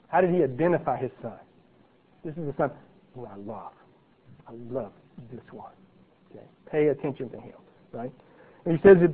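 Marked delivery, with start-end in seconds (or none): 2.2-5.7 tender father's voice. Acted. Voicing God's fond words.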